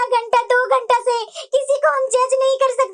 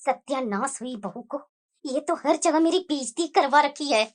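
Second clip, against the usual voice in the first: bitter grumbling curse; 9 semitones lower, 6 dB quieter than usual. Acted. Mother-in-law cursing her daughter-in-law, low bitter grumble. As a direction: spit the curse out under your breath.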